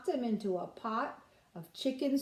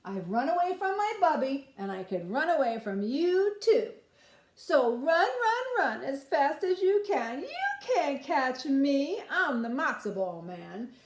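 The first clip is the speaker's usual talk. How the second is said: put-on taunting boy; 3 semitones higher than usual. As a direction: taunt them over your shoulder. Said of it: "He called back over his shoulder".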